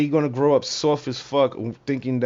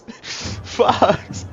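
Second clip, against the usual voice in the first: calling out; 8 semitones higher than usual. Real, not acted. Shouting the cue at co-host.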